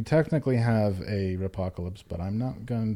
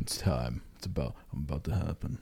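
much lower, quiet, solemn; low intense voice; 6 semitones lower, 9 dB quieter than usual. Retold or imitated. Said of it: "Imagine what he sounds like when he's coming".

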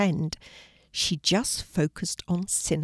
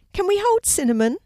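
put-on high, louder, quick child's question; eager child's request; 13 semitones higher, 6 dB louder, faster than usual. A narrator's reading. Mark begs teacher to hold hamster, eager and high. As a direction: ask like an excited schoolchild.

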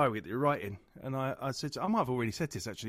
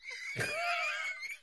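helpless shrieking laugh; shrieking laughter; high squealing laugh; 8 dB quieter than usual. Real, not acted. Hysterical laugh after joke.

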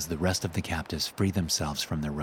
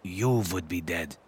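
lightly voiced Russian man, dry teasing. Lev teases the American.

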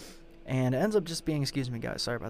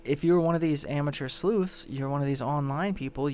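ordinary talk

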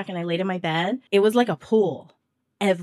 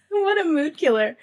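laughing; 9 semitones higher, 4 dB louder than usual. Real, not acted. Laughing at own gross story.

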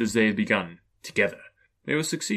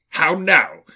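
higher, loud, caustic challenge; cold, raised-voice demand; caustic, cold Scrooge; 6 semitones higher, 7 dB louder than usual. A narrator's reading. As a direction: challenge the intruder sharply. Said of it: "caustic and cold as ever".

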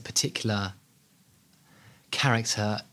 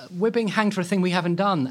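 higher, louder anguished protest; desperate plea; 8 semitones higher, 4 dB louder than usual. Retold or imitated. The condemned twins protest their innocence, desperate. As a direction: beg to be believed before the gallows. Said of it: "the Perot twins are like".

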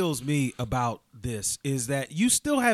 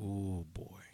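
whispered; 9 semitones lower, 15 dB quieter than usual. Real, not acted. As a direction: whisper it in dread. Reacting to grim letter.

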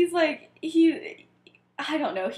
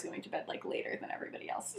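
whisper; 14 dB quieter, faster than usual. Real, not acted. Aside, dropped to a whisper.

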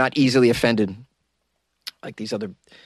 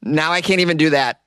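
ordinary delivery

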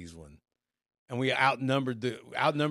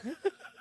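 high giggle; 6 dB quieter than usual. Real, not acted. Laughing at his own story.

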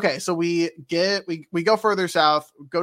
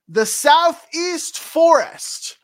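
slow, high, louder proclamation; game-text announcement; 11 semitones higher, 7 dB louder, much slower than usual. Acted. Game tells the party where, slow high proclamation. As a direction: draw out the place name grandly. Acts out in-game answer, co-host laughs.

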